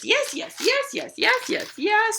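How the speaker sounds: high, yappy string of yeses; very high, rapid-fire puppy voice; excited puppy. Acted. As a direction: yap it like a thrilled puppy. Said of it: "the puppy runs out and says".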